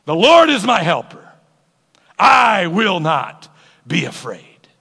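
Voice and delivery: loud, slow proclamation; confident declaration. Acted. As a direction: declare it boldly, stretching the words. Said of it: "we can say with confidence".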